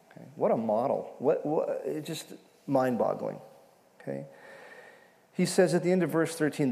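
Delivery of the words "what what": ordinary talk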